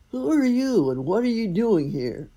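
loud, high giant's roar. Acted. Thunder Giant roaring at the cloaked stranger, loud and angry. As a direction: bellow it at the stranger. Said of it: "he roared".

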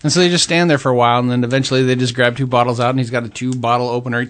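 ordinary delivery

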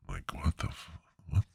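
whispered aside. Real, not acted. Comic whispering his confusion to the audience, hushed. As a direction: breathe it out, unvoiced. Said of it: "whispered aside".

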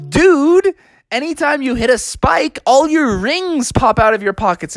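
high cartoon dude explaining; casual high-voiced game hero; Sonic. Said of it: "And Sonic's like".

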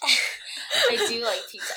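laughing while talking, slowly. Real, not acted. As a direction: laugh through the words. Laughs at the pizza joke.

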